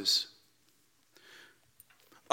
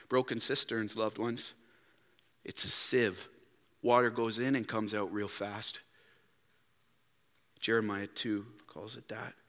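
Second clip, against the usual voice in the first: much softer, lower tender words; hushed pastoral; 8 semitones lower, 10 dB quieter than usual. Real, not acted. Pastor tenderly telling loved ones, hushed. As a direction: lean in gently.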